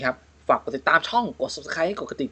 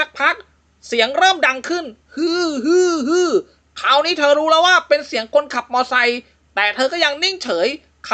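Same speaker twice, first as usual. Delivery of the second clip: raised storytelling voice, spooky build; ghost story narration; 7 semitones higher than usual. A narrator's reading. Host reads a ghost joke to co-host, storytelling voice. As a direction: tell it like a campfire ghost tale.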